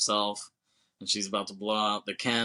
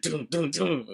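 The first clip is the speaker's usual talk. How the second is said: higher-pitched "doom, doom, doom" sound effect; three mock-dramatic booms; imitated zoom sting; 6 semitones higher than usual. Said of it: "triple cut zoom. Like, it's like".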